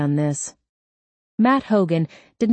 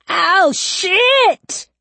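much higher, loud yelp of pain; pained scream; 6 dB louder than usual. Acted. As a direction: cry out as the ring is yanked. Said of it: "Gordon screamed".

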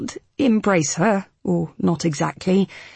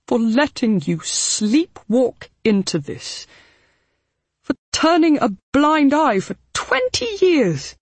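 lightly voiced remorseful older man; Abel's voice; 6 semitones higher than usual. Abel confessing to Hal, remorseful. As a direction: admit it all with shame.

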